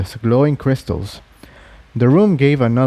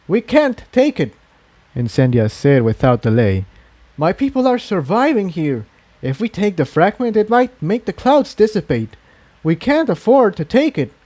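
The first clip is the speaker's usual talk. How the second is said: higher, insistent pony voice; refusing flatly, voice raised in pitch; Incendia, firm; 8 semitones higher than usual. A narrator's reading. Incendia refuses to take the fragment, firm higher voice.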